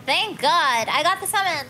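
loud, relieved burst; relieved excitement. Real, not acted. Player finally summoned into the zone, relieved excitement. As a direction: exclaim it with loud, happy relief.

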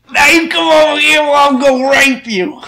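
very high, loud comic impression; exaggerated high-pitched celebrity caricature; Bill Cosby. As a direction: blurt it in a loud, squealing caricature. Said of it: "talk like Bill Cosby".

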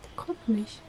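hushed whisper while hiding. Real, not acted. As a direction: whisper so the seeker can't hear. Hiding from child during hide-and-seek.

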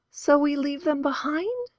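put-on high, soft girl's voice, unsure; uncertain question. A narrator's reading. A girl asks if they leave the men, soft and uncertain. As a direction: ask hesitantly, afraid of the answer. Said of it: "uncertainty in her voice".

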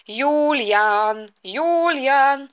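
drawn-out call to son. Acted. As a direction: call the name long across the house. Parent calls son from downstairs.

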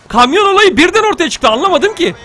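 high, loud protest; flustered complaint. Real, not acted. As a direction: complain in baffled frustration. Complaining after failure. (şaşkın, yüksek sesle yakınma)

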